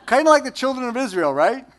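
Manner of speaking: normal talking voice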